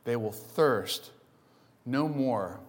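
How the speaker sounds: slow, low, weighty repetition; slow emphasis. Acted. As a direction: let each word land. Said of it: "preacher repeats slowly for emphasis".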